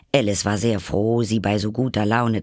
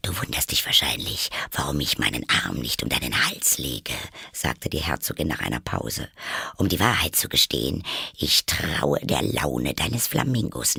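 put-on lower, sly old duchess; lower, smug Duchess voice; 6 semitones lower than usual. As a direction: talk down to the girl, a bit lower.

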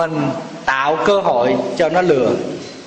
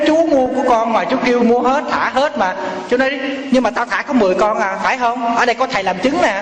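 put-on higher-pitched, protesting young seller; arguing back; 8 semitones higher than usual. Kid seller protests to the buyer, arguing back. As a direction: argue back in a higher voice, insistent.

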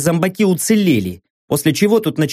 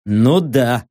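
low, grudging mumble of assent; grunted; 5 semitones lower than usual. A narrator's reading. Narrator answering while studying the wound, low grunt. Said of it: "буркнул я".

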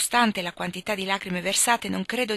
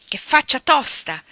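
loud, higher, slow cry of indignation; outraged shout; 6 semitones higher, 9 dB louder, slower than usual. Acted. Husband shouts at the butler's message, loud and indignant. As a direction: shout it at the servant's nerve. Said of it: "gridò".

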